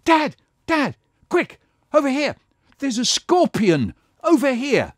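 high, urgent calling out; calling dad. Acted. Child calling dad to see a scorpion, high and urgent. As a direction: call dad over quick.

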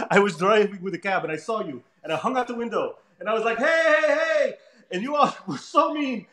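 high, plaintive French-accent imitation; Olivier Coipel. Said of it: "he talks like this".

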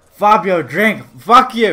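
loud, impatient order; frustrated shout. Pretend. Drinker pushing a beer on his horse, frustrated shout. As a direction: yell at a stubborn horse.

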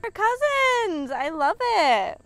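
loud, high, stretched-out shriek of delight; big drawn-out happy yell; excited squeal. Real, not acted. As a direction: squeal it with total glee. Delighted, showing off the piece.

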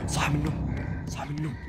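hushed breathy whisper. Real, not acted. Player whispers while sneaking past a sleeper. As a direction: whisper so the sleeper stays asleep.

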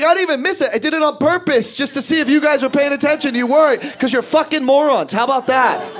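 put-on high, loud indignant shout at the kids; mock furious father; defensive dad yelling. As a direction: yell it like a dad covering his mistake. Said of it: "He's like".

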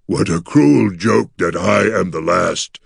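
mournful creature lament, often slowed; gargoyle near sobbing. A narrator's reading. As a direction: lament heavily in the monster's voice, with only a touch of a sob. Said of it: "The gargoyle's voice turned into a sob".

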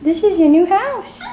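high sing-song pet voice; talking to a puppy. Real, not acted. Baby talk to puppy at home.

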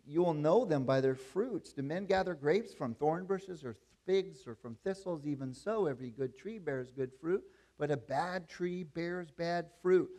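forceful pulpit reading of Jesus' words; scripture reading. Acted. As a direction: proclaim the verse to the congregation.

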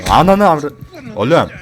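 loud, high cursing shout; startled yell. Real, not acted. Player jumped by an enemy, shouting a curse. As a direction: blurt it out in shock. Attacked suddenly in game.

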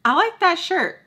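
imitating someone, pitched well up; high-pitched quote. Joe compliments Sam on the shirt, high mock voice.

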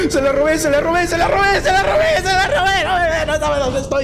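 building, high squealed chant; frantic glee. Real, not acted. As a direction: squeal it over and over, giddy with triumph. Stole the key, gleeful panic. (euforia chillona y desbocada)

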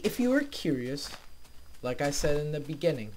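ordinary delivery

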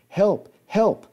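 high, loud cries; cry for help. Retold or imitated. Hunter locked in the trunk calling for help, loud cries. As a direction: yell from inside the trunk.

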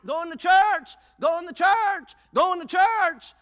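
high, loud, drawn-out refrain; insistent shout. Acted. Churchgoer turning down the block party, shouting back. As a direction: shout it back, refusing to be swayed.

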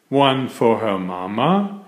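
ordinary delivery, nothing marked

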